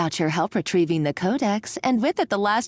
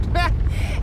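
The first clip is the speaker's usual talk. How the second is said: wicked laugh; 8 semitones higher than usual. Acted. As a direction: laugh like you've lost your mind. Villain laughs madly.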